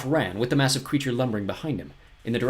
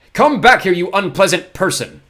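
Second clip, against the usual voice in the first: much higher, louder call; 9 semitones higher, 12 dB louder, slower than usual. A narrator's reading. Poe calls through the gate after Olaf, shouting. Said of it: "calling through the gate".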